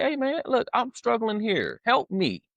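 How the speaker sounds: high, pleading cry for help; pleading prayer to God. Retold or imitated. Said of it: "how I'm saying it to you is how I said it to God".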